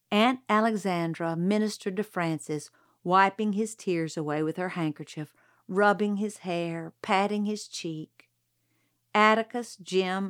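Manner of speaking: ordinary delivery, nothing marked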